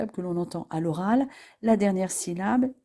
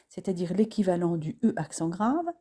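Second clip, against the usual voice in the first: ordinary delivery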